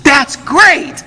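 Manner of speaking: high-pitched, rising retold cheer; delighted clerk. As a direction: imitate a clerk thrilled by the answer.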